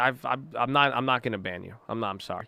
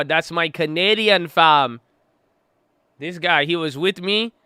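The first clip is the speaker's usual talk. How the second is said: ordinary talk, nothing marked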